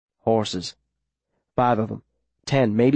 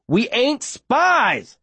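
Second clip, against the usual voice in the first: shouting; 13 semitones higher, much slower than usual. A narrator's reading. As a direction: yell it out in protest. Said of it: "I shout".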